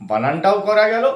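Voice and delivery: ordinary delivery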